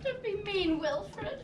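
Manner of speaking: softly hurt, hushed; gentle reproach. Acted. Aunt to nephew after his speech, gently reproachful. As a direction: chide him softly.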